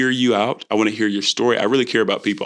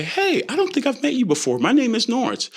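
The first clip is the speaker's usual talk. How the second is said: friendly introduction; 9 semitones higher than usual. Acted. Acting a confident introduction.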